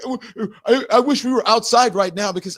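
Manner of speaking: high, loud blustering imitation; mock threat. Coward threatening from behind his girlfriend, mocking bluster.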